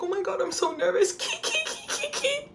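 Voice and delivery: high nervous giggle between words; nervous giggling fan. Retold or imitated. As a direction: giggle nervously while gushing. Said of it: "nonstop laughing while talking to me".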